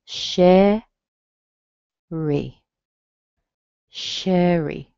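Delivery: "Sherry." strong SHE, weak little e; teacher's model word; model pronunciation. Shown as a demonstration. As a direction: repeat "Sherry." clearly. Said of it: "the little E at the end".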